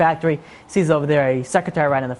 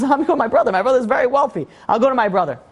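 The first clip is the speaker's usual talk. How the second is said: higher-pitched, retold lightbulb moment; sudden hopeful idea; 7 semitones higher than usual. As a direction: act out a man seizing on a new plan. Acted sudden idea, hopeful plan.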